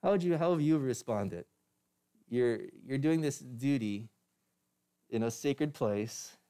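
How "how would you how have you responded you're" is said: normal talking voice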